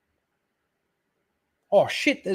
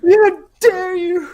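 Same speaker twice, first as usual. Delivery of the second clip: mock outrage; 8 dB louder, slower than usual. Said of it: "Kicking it".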